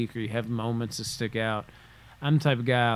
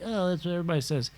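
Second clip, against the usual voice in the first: quick, higher brush-off; dismissive; 6 semitones higher, much faster than usual. Retold or imitated. Imitating a listener dismissing the message quickly. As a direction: toss it off like a cliché. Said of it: "And you're like".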